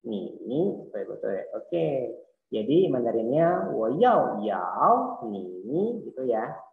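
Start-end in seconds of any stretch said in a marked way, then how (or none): 3.7-6.0 "wo yao yao ni" slowly, each "yao" with its own tone; slow Mandarin tone model; tone demo. Shown as a demonstration. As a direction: contrast the two tones on "yao yao", slowly. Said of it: "Lihat ya".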